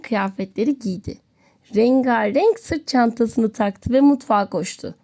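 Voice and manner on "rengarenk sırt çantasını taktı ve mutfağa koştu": ordinary delivery, nothing marked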